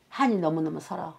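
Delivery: normal talking voice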